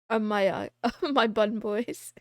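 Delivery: normal talking voice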